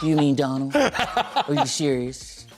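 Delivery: low slow Trump voice; Donald Trump impression. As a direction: drag out believe me like Trump. Trump impression answering question.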